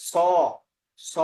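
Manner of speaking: স as a hissed "sa"; correct pronunciation. Shown as a demonstration. Teacher models the sound, correct pronunciation. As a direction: say স with the tongue at the teeth, "sa". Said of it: "স এর মতো হবে".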